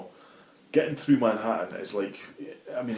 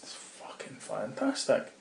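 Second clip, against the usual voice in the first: slow hushed whisper, much softer; awed whisper; 8 dB quieter than usual. Retold or imitated. Telling his friend about New York, slow awed whisper. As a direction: breathe it out in quiet disbelief.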